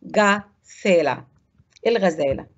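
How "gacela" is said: "جاسيلا" very slow, split after hard-g "ga"; teacher's slow model of "جاسيلا"; slow syllable demo. Shown as a demonstration. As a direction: stretch "جاسيلا" out as ga, pause, thela. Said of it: "نفس الطريقة بقول جا".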